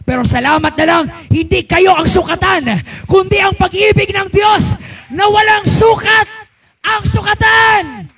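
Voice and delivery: rising to a full-throated shout; preaching shout. Real, not acted. Preacher lifting the congregation in a loud, high shout.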